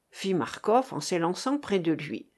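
ordinary delivery

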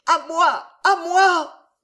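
very high, loud scream; crying for help. A narrator's reading. Jeanne screaming for François to save her. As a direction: shriek for help with everything. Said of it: "hurla Jeanne délirante".

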